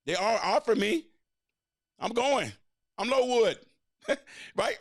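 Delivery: strutting, self-mocking brag; cocky boast. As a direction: puff up like a cocky teen star.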